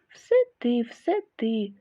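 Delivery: plain reading voice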